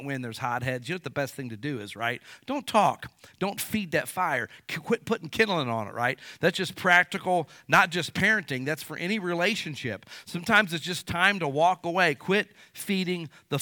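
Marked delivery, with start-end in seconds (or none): none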